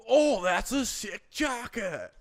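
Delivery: slow, high, laughing stoner surfer; Sean Penn impression. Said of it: "Fast Times at Ridgemont High".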